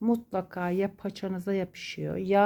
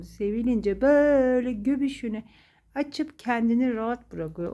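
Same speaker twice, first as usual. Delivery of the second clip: ordinary delivery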